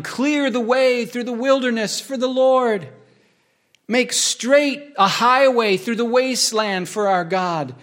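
slow, high proclamation of a prophet's cry; proclaiming scripture. Acted. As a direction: cry it out slowly like a herald in the desert. Voice shouting in wilderness read.

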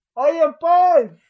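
loud high call across a crowd. Retold or imitated. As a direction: call to a friend at the far end of a bus. Calling Paul across the bus. (llamado a gritos)

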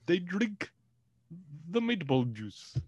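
high, slow mock Italian accent; bad Italian accent. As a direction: stretch it out like a cartoon Italian. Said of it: "We gotta do twenty seconds of bad Italian".

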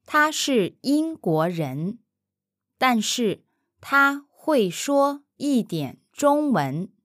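syllable by syllable, slowly and carefully; slow careful model. Shown as a demonstration. Mandarin teacher modelling for the learner, slowly and carefully. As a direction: spell out 他是英国人，但是他会说一点中文 slowly for the learner. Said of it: "slow model take before repeat".

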